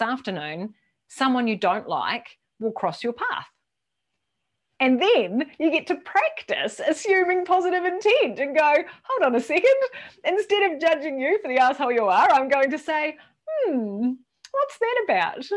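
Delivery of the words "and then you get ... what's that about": high, put-on sing-song inner voice; mock inner voice. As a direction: act out the snide thought lightly. Mocking inner monologue about a colleague.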